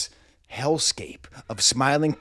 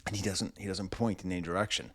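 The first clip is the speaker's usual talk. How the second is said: usual speaking voice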